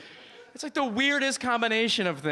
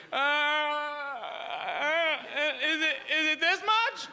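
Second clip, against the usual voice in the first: high, helpless, unsure voice rising to a squeak; confused little kid; 8 semitones higher, slower than usual. Retold or imitated. Tourist fumbling foreign coins at the counter, high helpless voice. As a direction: fumble like a kid paying for the first time.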